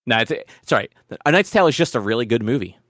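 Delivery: ordinary talk, nothing marked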